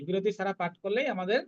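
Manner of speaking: ordinary talk, nothing marked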